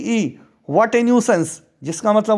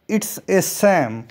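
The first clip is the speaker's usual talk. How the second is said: very slow, clear model reading; slow model phrase; much slower than usual. Shown as a demonstration. Teacher modelling it slowly for students. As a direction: spell it out slowly for learners. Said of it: "इस प्रकार से भी कह सकते हैं".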